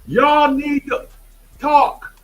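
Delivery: hammed-up coach at practice; imitating a drill sergeant coach. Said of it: "He says".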